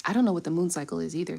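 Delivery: ordinary talk, nothing marked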